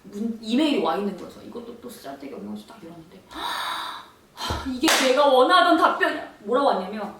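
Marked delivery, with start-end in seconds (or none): 3.3-4.0 sharp unvoiced gasp of surprise; surprised gasp. Opening the long-awaited email, gasping. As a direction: gasp at the news.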